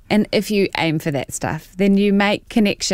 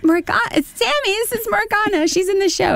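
lightly voiced woman, much higher; high-pitched wife voice. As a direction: squeak it out bright and high.

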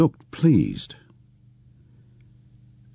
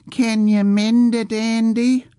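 high, slow mocking copy of an old man's childish voice; mimicking childish voice; slower than usual. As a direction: parrot the old man's whine to tease him. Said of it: "repeated in the old man's childish voice".

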